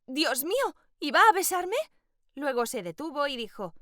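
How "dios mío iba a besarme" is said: excited. A narrator's reading. Girl thrilled at near kiss.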